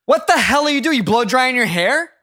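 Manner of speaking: high, loud, incredulous son; hammed-up fed-up son, stretched words; exasperated son. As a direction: call out to mom in mock outrage. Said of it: "I'm gonna be Nick".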